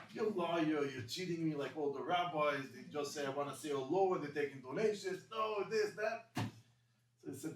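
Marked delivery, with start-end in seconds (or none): 0.1-6.3 loud, rising angry rant; an angry father yelling at his son. Retold or imitated. Father shouting at his son about the rabbis, angry. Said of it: "His father was very angry".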